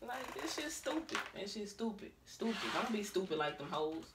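quiet dismissive mutter; muttered aside. Real, not acted. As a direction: mutter it to yourself. Muttered aside about stupid.

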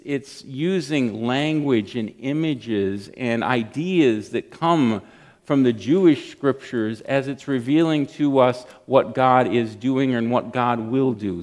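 ordinary talk

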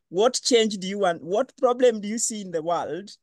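usual speaking voice

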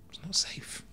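voiceless, very soft aside; barely audible retold disbelief; hushed echo. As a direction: whisper it to yourself. Echoes warning in disbelief.

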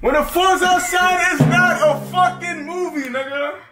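high, loud ranting. Real, not acted. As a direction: shout it out in disbelief. Ranting about friend.

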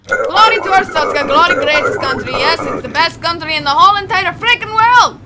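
mock patriotic proclamation. Inspector praising the glorious country, mock patriotic proclamation. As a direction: proclaim it with hammed-up patriotic zeal.

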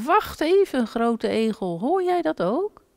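put-on high, childlike little hedgehog voice; high little hedgehog call. A narrator's reading. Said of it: "toen riep de kleine egel".

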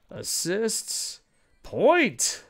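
a sudden high, loud cheer of the name; real excitement at a player's stats; excited. Real, not acted. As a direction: blurt the name out in delight. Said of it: "I don't know why I got so excited".

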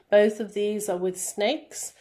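usual speaking voice

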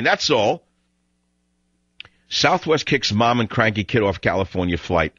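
normal talking voice

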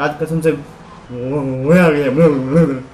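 slow, stretched cry of joy; long, sung-out happy exclamation; drawn-out delight. Real, not acted. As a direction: savour every word of the thrill. Thrilled after a drift.

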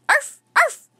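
high, loud yappy barks; little dog barking. A narrator's reading. Teddy barking from the pack, high and loud. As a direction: yap like a small dog. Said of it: "Teddy barked from Jack's pack".

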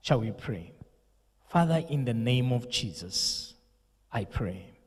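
usual speaking voice

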